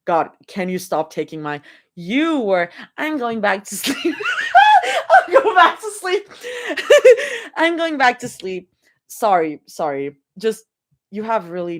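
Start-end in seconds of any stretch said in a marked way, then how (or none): 4.2-5.0 bursting into giggles; high giggle. Real, not acted. Laughing at the teasing. 5.1-6.3 words pushed out through giggles; laughing. Real, not acted. Laughing while repeating the line. 6.3-7.5 a run of giggles. Real, not acted. Laughing at the teasing.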